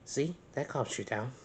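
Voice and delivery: ordinary delivery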